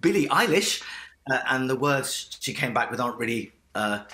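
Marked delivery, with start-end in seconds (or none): 0.0-0.8 higher, louder name drop; retold excited reveal. Dad retells telling his daughter who duetted, excited reveal. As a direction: re-enact announcing astonishing news.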